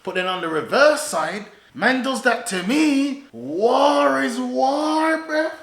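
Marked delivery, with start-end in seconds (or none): 3.3-5.5 drawn out, every word weighted; slow emphatic. Real, not acted. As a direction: lay it down slowly. Emphatic point.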